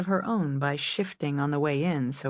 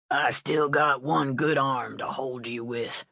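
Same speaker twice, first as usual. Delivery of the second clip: Sam Elliott; 3 semitones lower than usual. Acted. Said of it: "the iconic, gravelly voice".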